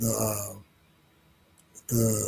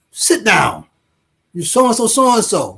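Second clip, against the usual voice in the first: stern parent; 9 semitones higher than usual. Retold or imitated. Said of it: "I'll say".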